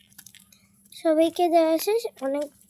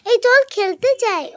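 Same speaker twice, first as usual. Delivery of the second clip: calling friends; 7 semitones higher, 5 dB louder than usual. Pretend.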